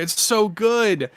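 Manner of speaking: high, drawn-out mock gushing; retold excited scream. Fan retelling his shouted answer in the car, excited mock scream. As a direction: stretch it out high, reliving the excitement. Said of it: "I'm screaming in my car".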